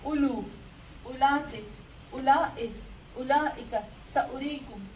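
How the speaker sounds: loud, deliberate reading of each word with a short u; clear demo reading. Shown as a demonstration. Teacher reads the word list aloud to her student, loud and deliberate. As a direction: spell out each word like a model for the class. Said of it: "हम पढ़ेंगे उला".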